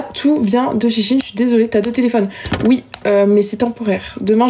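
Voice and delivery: ordinary talk, nothing marked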